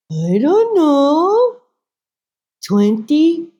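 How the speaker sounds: very slow, high, loud singsong; evasive mother. Retold or imitated. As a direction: stretch it upward as if unsure. Mother answers sheepishly in story.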